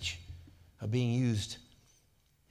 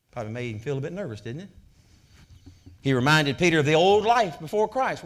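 normal talking voice

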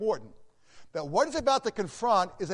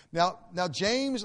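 normal talking voice

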